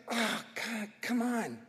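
soft, exasperated sigh of disbelief; frustrated groan. Retold or imitated. Preacher voicing Elisha's frustration at Jehoash, soft exasperated groan. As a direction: let it sag out under your breath, fed up. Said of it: "frustration and anger with Je-, uh, Jehoash".

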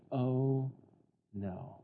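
mock dread, sinking low and quiet; imitated sinking dismay; freaked out. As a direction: let a drawn-out oh drop to a hushed no. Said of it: "them and Jacob really freak out now".

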